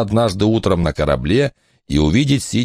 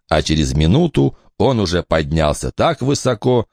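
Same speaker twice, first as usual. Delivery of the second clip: ordinary delivery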